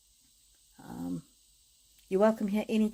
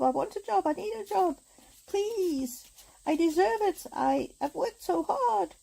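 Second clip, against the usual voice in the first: much higher, pleading prayer voice; retold begging prayer; 10 semitones higher than usual. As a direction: beg upward like a desperate prayer. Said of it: "I've been praying and begging and going".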